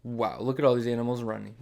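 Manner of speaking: ordinary delivery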